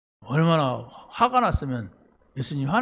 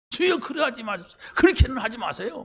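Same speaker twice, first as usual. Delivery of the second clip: pleading; 7 semitones higher than usual. Retold or imitated. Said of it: "막 이런 거예요".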